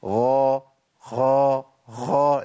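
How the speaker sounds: "غا" with the غ dragged too long; overlong ghain. Shown as a demonstration. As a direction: stretch the غ of "غا" past its length. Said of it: "لا ده الخطأ". (إطالة صوت الغين في "غا")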